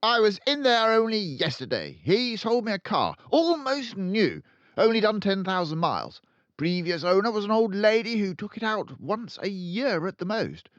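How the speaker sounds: loud, high, boastful barking about a bargain; Trunchbull barking. A narrator's reading. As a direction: headmistress bragging to Miss Honey, barking. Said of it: "She either barked or shouted".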